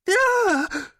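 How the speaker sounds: high shriek of pain; pained yell. Acted. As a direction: yell as the hammer lands. Hammer slammed down.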